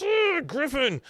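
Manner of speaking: imitating Luann scolding Griffin; exasperated scolding. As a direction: scold him like a fed-up housemother. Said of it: "She goes".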